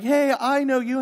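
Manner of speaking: bright, high, loud hail of a fan; eager recognition. Retold or imitated. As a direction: call out like you've spotted someone famous. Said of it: "and they're like".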